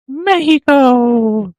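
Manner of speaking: Mexico drawn out slow and high with a breathy h, "Mé-hi-co"; Spanish-style Mexico. Pretend. Hyping up the Mexican listeners with a stretched Spanish "Mexico". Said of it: "Shout out to Mexico".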